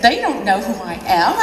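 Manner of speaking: ordinary talk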